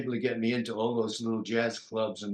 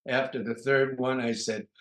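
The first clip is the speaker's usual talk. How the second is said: ordinary talk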